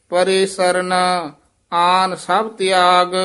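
measured, level intoned Gurbani chant; scripture recitation. Acted. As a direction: intone each phrase evenly, drawing out the endings.

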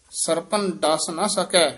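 slow ਸਰਪਨ ਡਸ ਨਾ ਸਕੈ, falling, then a halt; ਸਕੈ with a light stop; brief halt after. Shown as a demonstration. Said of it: "ਇੱਥੇ ਠਮਕ ਹੈ".

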